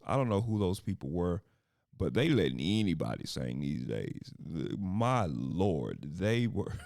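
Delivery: usual speaking voice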